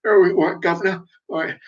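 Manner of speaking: high hammed-up cockney street urchin. Oliver Twist urchin greeting a gent: high mock cockney. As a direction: play a Dickens urchin in cockney. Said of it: "street urchin in the fucking Oliver Twist movie".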